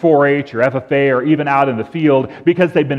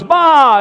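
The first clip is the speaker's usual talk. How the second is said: high, loud "baa"; sheep bleat; 10 semitones higher, 6 dB louder than usual. Acted. First dumb sheep crying from the pit, bleating. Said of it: "the first one goes".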